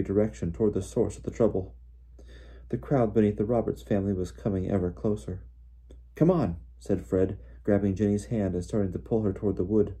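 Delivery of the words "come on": higher, urgent hurrying call. A narrator's reading. Fred pulling his sister toward the wood, urgent. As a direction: urge them to follow you now. Fred urging the others to flee.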